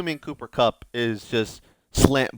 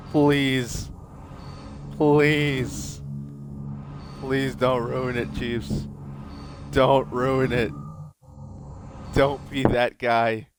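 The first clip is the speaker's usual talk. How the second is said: slow, drawn-out pleas; pleading; much slower than usual. Real, not acted. Fan willing the Chiefs not to ruin it, pleading. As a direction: stretch each word out, begging. Begging at a game result.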